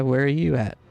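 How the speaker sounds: normal talking voice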